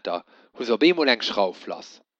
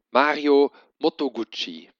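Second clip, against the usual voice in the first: plain reading voice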